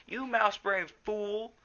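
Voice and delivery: high, slow, angry spitting; spat insult. A narrator's reading. Graypaw scolding Firepaw after the near miss, angry spat insult. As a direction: spit the insult at him. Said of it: "spat Graypaw".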